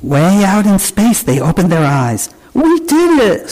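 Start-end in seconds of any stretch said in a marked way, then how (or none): 2.6-3.5 high, amazed storybook gasp; mildly acted wonder, pitched high. A narrator's reading. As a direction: read it as a child's astonished cry, much higher. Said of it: "Susie gasped".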